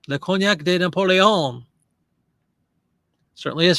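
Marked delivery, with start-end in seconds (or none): 0.1-1.7 mock French. Reading a cognac label to viewers in mock French. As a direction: savour it like a French waiter.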